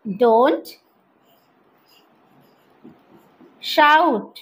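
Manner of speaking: I ... live ... near ... here, each word set apart; slow word-by-word drill. Shown as a demonstration. Teacher drilling a new phrase, slow word by word. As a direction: separate every word with a long pause. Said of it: "teacher drills word by word".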